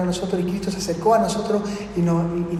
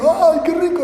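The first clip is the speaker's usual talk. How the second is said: high, thrilled gasp at the food; a starving man's sudden joy; ¡Ay! rising high with glee; delighted exclamation; 8 semitones higher, 3 dB louder than usual. Acted. Starving lepers find food.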